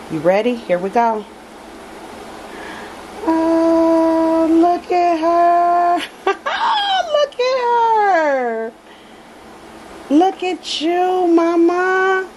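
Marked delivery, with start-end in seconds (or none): 3.2-8.7 high, loud, slow cry of joy, laughing through tears; real happy crying, voice way up; tearful joyful cry. Real, not acted. First sight of the new doll. 10.1-12.3 cooing. Real, not acted. Cooing to the unveiled doll.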